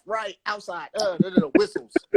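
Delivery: jumble of high yelps and shouted cues; skate calls. Shown as a demonstration. Skater imitating calls at national skate parties, high yelps. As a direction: fire off assorted calls high over music. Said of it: "all you hearing is, is skate calls".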